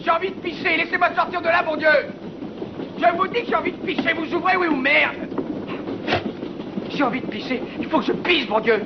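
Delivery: high, loud frantic yelling; desperate shouting. Acted. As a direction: pound the door and scream to get out. Locked prisoner begging to be let out.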